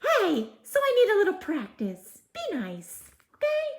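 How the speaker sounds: high, fast, hurt little voice; fairy puppet voice. Acted. Fairy puppet Sparkle telling the reader to be nice, high and fast. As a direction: protest like an offended tiny fairy. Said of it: "Oh, I'm sorry, Sparkle".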